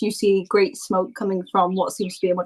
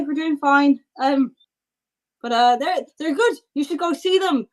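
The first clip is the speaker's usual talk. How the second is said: higher-pitched character voice, full of ums; higher, hesitant in-character chatter; chatty, hesitant townsperson; 6 semitones higher than usual. Acted. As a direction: play a polite local making small talk, pitched up. Game master voices chatty townsperson.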